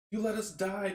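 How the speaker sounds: lightly voiced accusing dead; accusing chorus of voices. The drowned crew to their captain, lightly accusing. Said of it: "a chorus of voices".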